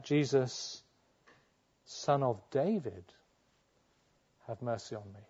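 ordinary delivery

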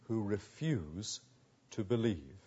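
quiet, grieving question; hushed sorrow. Real, not acted. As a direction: let it fall away sadly. Hushed rhetorical end.

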